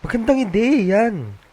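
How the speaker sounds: high, slow delighted agreement. Acted. Catherine gladly agreeing to Ben's idea, high and delighted. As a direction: savor the idea with glee. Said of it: "Sobrang galak na sumang-ayon".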